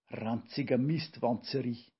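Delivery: ordinary delivery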